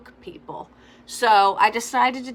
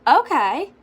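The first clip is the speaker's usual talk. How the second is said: higher, louder startled "Okay"; mock surprised okay; 7 semitones higher, 7 dB louder than usual. Answering the friend's suggestion, mildly surprised. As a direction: agree half-surprised. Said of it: "I was like".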